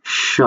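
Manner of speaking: "স" sounded alone as sh; demonstrated SH sound. Shown as a demonstration. Teacher sounding out a phonetic symbol, "স" as sh. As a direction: give "স" as a drawn-out sh example. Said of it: "এই চিহ্নটা অনেকটা উচ্চারণ করে".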